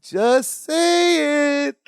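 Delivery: high, very drawn-out mock pleading; sing-song demand. Imitating Larry pressing Balky to spill it, sing-song demand. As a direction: stretch the words out in a singsong.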